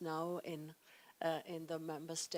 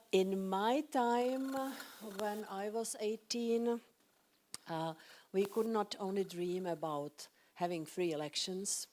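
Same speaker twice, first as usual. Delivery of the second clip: ordinary talk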